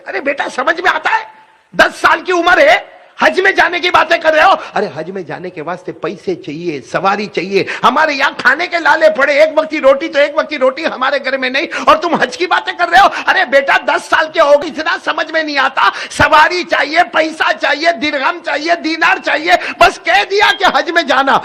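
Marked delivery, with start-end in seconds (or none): 0.1-4.5 high, loud scolding of a foolish son; angry mother. Acted. Mother furiously scolding her ten-year-old son, high and loud. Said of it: "وہ غصے ہو گئی". 7.8-21.4 high, loud scolding of a foolish son; angry mother. Acted. Mother furiously scolding her ten-year-old son, high and loud. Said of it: "وہ غصے ہو گئی".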